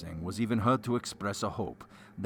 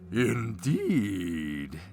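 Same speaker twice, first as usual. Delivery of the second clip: hammy stage actor. A narrator's reading. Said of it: "ejaculated the Baron".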